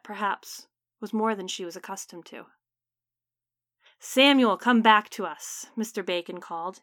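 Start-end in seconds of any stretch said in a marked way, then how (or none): 4.0-5.7 booming call. Acted. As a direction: call out over the wind to the dead. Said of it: "his voice booming over the wind outside".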